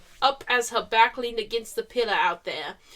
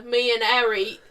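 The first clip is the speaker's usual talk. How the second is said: ordinary talk, nothing marked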